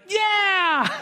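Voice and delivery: put-on high, sudden happy toddler yes; a small child brightening at a treat; delighted toddler. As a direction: toddler cheers yeah. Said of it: "stopped crying instantly".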